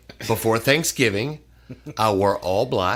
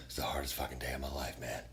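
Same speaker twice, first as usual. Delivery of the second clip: quiet, fast, much lower voice; sad confession; 9 semitones lower, 14 dB quieter, faster than usual. Retold or imitated. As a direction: mutter it like a broken man. Boss confessing sadly.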